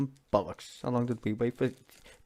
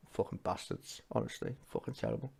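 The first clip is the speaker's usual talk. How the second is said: much softer, half-whispered frustration; bitter mutter; 11 dB quieter than usual. Real, not acted. Streamer muttering to viewers about government, soft and bitter. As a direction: mutter it under your breath in disgust. Quiet bitter aside after statement.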